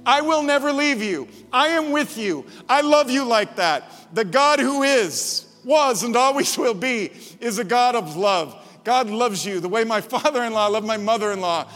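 loud, high fervent preaching. Acted. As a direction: build the sermon to full force. Preaching at full force.

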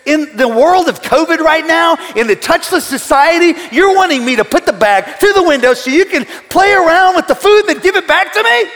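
high, loud outraged retort; high, loud mock outrage at the cashier; indignant. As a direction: fume at the drive-thru lady. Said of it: "And I said".

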